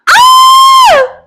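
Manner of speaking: gasp, then a high, loud sustained scream; sudden high-pitched shriek after a sharp intake; real scream. Real, not acted. Card reader sees the bottom card, screaming. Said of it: "Sorry for screaming in your ear".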